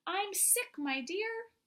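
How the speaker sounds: wolf as grandmother. Acted.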